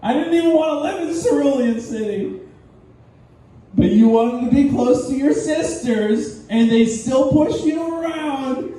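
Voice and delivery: high, aggrieved marital grievance; mock bitter rant. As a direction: throw old grievances back at her. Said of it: "He's like".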